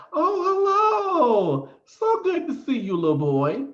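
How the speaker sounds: put-on high-pitched, drawn-out hello to a child; gushing adult greeting. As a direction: imitate a man fussing over a little kid. Man greets supposed child trick-or-treater.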